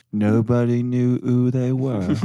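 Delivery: put-on slow Spinal Tap-style British accent; imitated British accent. Guest quoting a line from Spinal Tap, slow imitated British accent. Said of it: "imitating someone doing a British accent".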